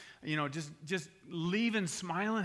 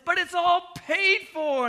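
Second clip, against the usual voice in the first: high, very loud, stretched-out cashier call; acted triumphant holler, drawn long; gleeful announcement; 12 semitones higher, 10 dB louder, slower than usual. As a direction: belt it out like a game-show reveal. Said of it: "the cashier started getting into it".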